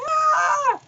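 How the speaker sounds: sudden high shriek, mock scream; child's scream. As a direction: shriek like a frightened girl. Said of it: "and she screams".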